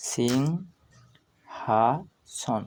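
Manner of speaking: সিংহাসন extremely slow, pauses between syllables; pronunciation demo. Shown as a demonstration. Teacher breaks up the word for learners, সিংহাসন drawn out. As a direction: say sing, ha, son separately for সিংহাসন. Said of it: "এর উচ্চারণ কিভাবে করতে হয়".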